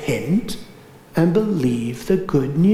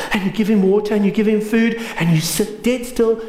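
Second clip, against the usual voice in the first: usual speaking voice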